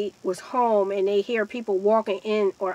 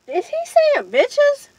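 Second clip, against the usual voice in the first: very high, louder incredulous question; retold disbelief; 6 dB louder, slower than usual. Storyteller recalls overhearing the insult, high-pitched disbelief.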